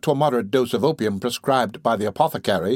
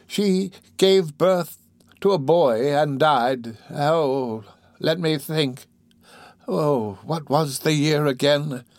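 put-on slow, higher-pitched old woman voice; sick old woman recalling; 4 semitones higher, slower than usual. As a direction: drag the words out as if searching your memory. Dying old woman recounting her memory.

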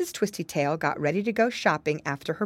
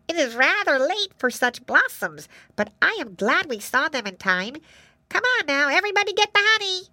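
high bee voice, rising to a call; rally the swarm in a tiny high voice; eager little bee; 10 semitones higher than usual. A narrator's reading. Bee calls the others to the blossoms, eagerly.

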